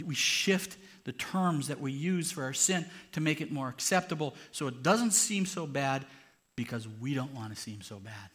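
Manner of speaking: normal talking voice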